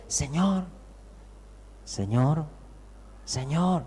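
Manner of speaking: soft, polite call to wake someone; understated, hushed appeal; timid call. Acted. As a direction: call out softly, hesitantly, as if not to disturb. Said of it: "que no dijeron".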